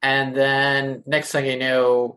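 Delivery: normal talking voice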